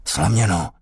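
ordinary delivery, nothing marked

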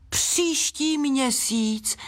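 high, long-drawn squeal; dismayed squeal. A narrator's reading. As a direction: squeal in disbelief, stretching it out. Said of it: "vypískla Gladiola". (zděšené vypísknutí)

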